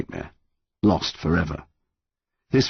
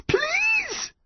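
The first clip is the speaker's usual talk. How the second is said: high, strained begging; desperate plea to himself; 3 dB quieter than usual. A narrator's reading. Son begging to himself as his mum slips, pleading. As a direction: squeeze out a pleading cry. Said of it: "Connor whispered to himself".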